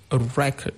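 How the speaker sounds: record said as REC-ord; noun stress. Shown as a demonstration. Teacher showing the noun, first-syllable stress. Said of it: "stress on the first syllable".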